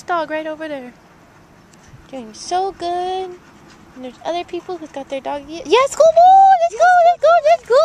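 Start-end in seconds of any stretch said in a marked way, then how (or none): 5.6-7.8 high, loud, stretched cheering praise; excited dog praise. Real, not acted. Owner cheering her dog as they walk on, loud excited praise. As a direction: cheer him on like he just won a race.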